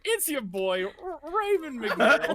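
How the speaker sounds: slow, high, bad Australian accent. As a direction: ham up a terrible Australian accent. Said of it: "the worst Australian accent".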